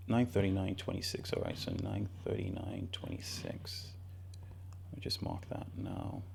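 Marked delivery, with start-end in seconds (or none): 1.3-6.2 much lower, whispered self-talk; whispering. Real, not acted. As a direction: mutter it under your breath while working. Muttering to himself while marking chart.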